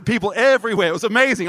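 normal talking voice

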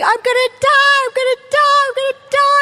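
high, loud frantic cry; panicked screaming. Retold or imitated. Preacher reenacting her panic under the heat lamps, frantic screaming. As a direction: scream it like you're dying. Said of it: "I'm screaming".

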